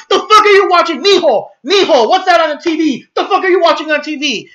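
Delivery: high, loud imitated scolding; outraged mother. Mother shouting at her son about TV, mock outrage.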